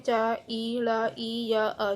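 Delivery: slow, separated letter sounds; teacher breaking a word into pieces; sounded out by letter. Shown as a demonstration. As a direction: spell it out one sound at a time. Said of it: "सबको अलग-अलग word करके".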